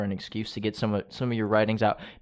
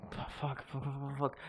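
very quiet, rapid cursing to oneself; hushed, scrambling aside; flustered muttering; 3 semitones higher, 14 dB quieter than usual. Real, not acted. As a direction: mutter it under your breath while hunting for words. Host lost for what comes next.

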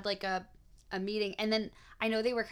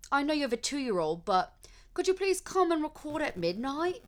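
higher-pitched put-on British accent; 6 semitones higher than usual. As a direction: plead politely in a playful British accent. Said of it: "I'm gonna do it in a British accent".

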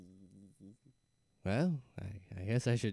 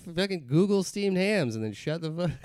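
exasperated; 9 semitones higher, 5 dB louder than usual. Real, not acted. Exasperated at the narrator.

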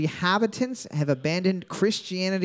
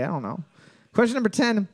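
ordinary delivery

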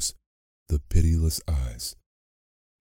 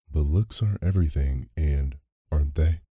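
ordinary delivery, nothing marked

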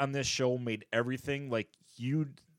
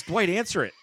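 imitating; 7 semitones higher, 5 dB louder than usual. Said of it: "He goes".

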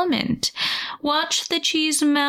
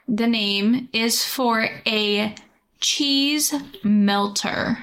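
slow pitch; much slower than usual. Acted. Salesy product pitch.

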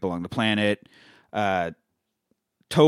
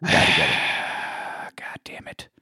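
real fed-up whispered curse; exasperated whisper; 7 semitones lower, 4 dB quieter, much slower than usual. Real, not acted. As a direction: sigh then whisper it, slow and low. Sigh of exasperation.

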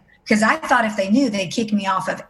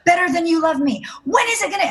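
high-pitched, anguished retold yell; yelling at God; 10 semitones higher than usual. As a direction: reenact an angry protest, pitched way up. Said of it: "yelling at him".

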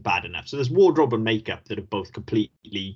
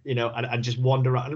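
ordinary delivery

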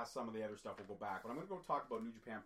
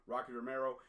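usual speaking voice

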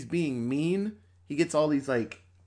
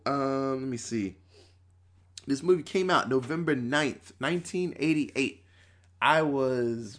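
normal talking voice

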